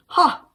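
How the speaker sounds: a loud, disbelieving bark of a laugh; scoffing laugh. Acted. Susan scoffs at Martin's excuse with a loud high "Ha!".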